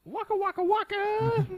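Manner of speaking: waka waka. Acted. Pac-Man style call.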